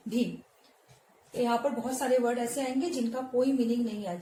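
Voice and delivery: ordinary talk, nothing marked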